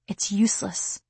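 usual speaking voice

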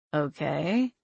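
low, resigned sigh of a word; sighing, reluctant agreement. A narrator's reading. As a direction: say it on a sigh, lifting at the end. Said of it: "I sighed".

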